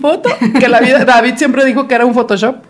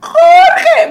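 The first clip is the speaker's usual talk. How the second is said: much higher, thrilled cry of the name; delighted squeal; 4 dB louder than usual. Retold or imitated. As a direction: squeal the name like a star seeing a friend. Celebrity's delighted greeting.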